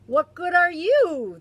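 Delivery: loud high parrot mimic; parroting bird. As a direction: throw it back like a cheeky parrot. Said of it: "the parrot asks".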